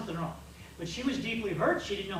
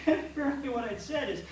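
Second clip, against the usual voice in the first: ordinary talk, nothing marked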